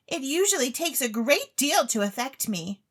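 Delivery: put-on high, eager young lady pleading; a rich, silly girl begging to go on stage; Claribel's voice. Claribel begs the chemist for stage talent, high and eager.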